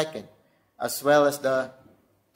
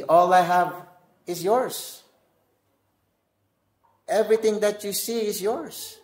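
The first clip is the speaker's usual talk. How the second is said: tender pleading father; 5 semitones higher than usual. Acted. Father pleads with older son.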